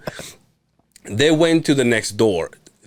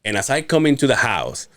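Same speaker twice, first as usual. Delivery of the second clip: normal talking voice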